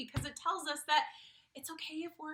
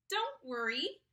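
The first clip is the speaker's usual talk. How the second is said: ordinary delivery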